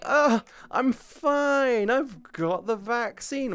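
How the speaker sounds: high, drawn-out mock carefree voice; mock the careless streamers breezily. Mocking careless streamers who skip precautions, high and drawn out.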